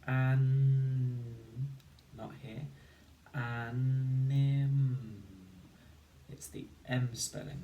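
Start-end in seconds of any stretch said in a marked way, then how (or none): 0.1-1.2 loud, drawn-out first syllable; stretched syllable. Shown as a demonstration. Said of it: "Listen carefully". 3.3-5.2 loud, careful sounding of Anim. Shown as a demonstration. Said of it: "Listen carefully".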